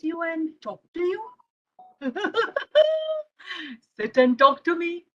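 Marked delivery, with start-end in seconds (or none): 2.0-3.2 giggle. Acted. Child laughs mid-sentence.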